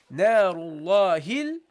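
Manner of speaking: Narullahil slow, joined to lam; teacher demonstrating the connection; slow recitation. Shown as a demonstration. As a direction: link Narullahil smoothly into the lam. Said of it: "You say".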